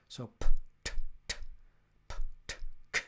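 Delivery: p t k as loud unvoiced puffs; teacher demonstrating p, t, k with no voice; voiceless sounds. Shown as a demonstration. Teacher to learners, hand on throat, voiceless p, t, k. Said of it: "There's no voice".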